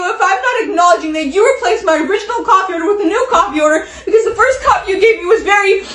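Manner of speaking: fast, breathless-run tirade; rapid-fire acted complaint; exasperated rant. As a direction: rattle it off without stopping.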